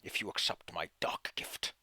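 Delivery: low unvoiced tempting whisper; eerie whisper. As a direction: whisper the offer darkly. DM voicing dark gift.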